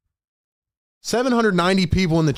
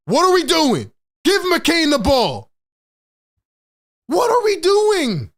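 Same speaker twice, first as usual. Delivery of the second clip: high, loud angry shouting; frustrated shout; 11 semitones higher, 3 dB louder, slower than usual. Real, not acted. Fan yelling at Nurse about the lineup, angry shout. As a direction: shout at the coach in disbelief. Fan raging at coach's decisions.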